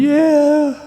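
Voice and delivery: high, loud wailed impression of a crying dad; mock-sob it in one long, high wail; hammed-up tearful howl; imitated weeping father. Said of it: "And poor Dad going".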